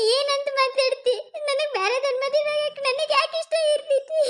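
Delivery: high, slow tearful protest; crying. Acted. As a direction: speak through the tears, hurt and disbelieving. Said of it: "ಅಳ್ಬೇಡ". (ಅಳುತ್ತಾ ನೋವಿನಿಂದ ಕೇಳುವ ಧ್ವನಿ)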